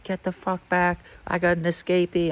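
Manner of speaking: high mock bark at an escapee; guard yelling. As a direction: yell him back from the door, high-pitched. Guard yells at fleeing inmate.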